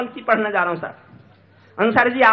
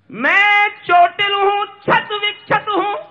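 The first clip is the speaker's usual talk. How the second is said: very high, drawn-out poetic cry; keening, stretched declamation; anguished verse; 10 semitones higher, 6 dB louder, much slower than usual. Acted. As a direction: cry out the wounds slowly. Anguished climax of stage poem.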